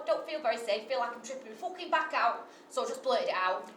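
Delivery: normal talking voice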